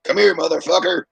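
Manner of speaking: high, loud, growled-out put-on thug voice; mock gunman threat. Imagining a trained student shooting up a Walmart, loud mock threat. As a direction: bark it like a deranged shooter. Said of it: "started fucking going".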